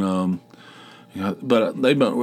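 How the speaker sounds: ordinary delivery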